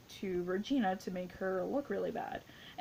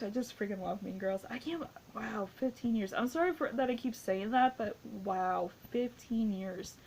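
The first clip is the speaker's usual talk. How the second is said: ordinary talk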